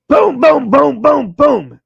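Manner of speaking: loud, high booming knocks, like fists on a door; imitated banging. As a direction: pound out each boom on the door. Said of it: "it starts banging like that".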